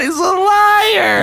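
high laughing shout, slowed down; mock accusing laugh. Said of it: "You got me".

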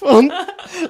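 bursting into laughter; loud laughing; loud high laugh. Real, not acted.

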